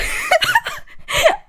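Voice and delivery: high squealing laugh. Real, not acted. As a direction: burst out in a high-pitched cackle. Cracks up at the book's line.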